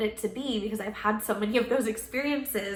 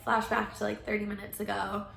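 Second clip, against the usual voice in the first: normal talking voice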